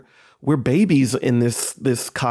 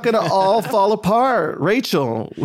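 raised and higher, each word pressed home; emphatic repeat; 8 semitones higher than usual. Shown as a demonstration. Repeating the line on request for Rachel, emphatic and raised. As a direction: say it again with full conviction for Rachel. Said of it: "Say it again".

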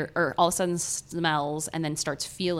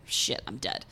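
whisper; 9 semitones lower, 7 dB quieter than usual. Retold or imitated. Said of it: "he just is like".

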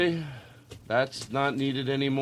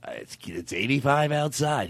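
Casey Kasem impression. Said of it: "And he goes".

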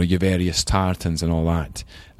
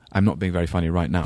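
ordinary talk, nothing marked

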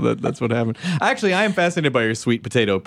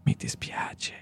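hushed, whispered repeat; soft whispered aside; whispered Italian; 10 dB quieter, much slower than usual. Pretend.

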